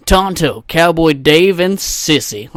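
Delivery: loud mock DJ intro of the show's crew; country radio host. Podcaster mocking his town's radio crew, country DJ voice. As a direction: announce it big, hammed-up. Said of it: "country radios".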